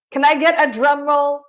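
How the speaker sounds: usual speaking voice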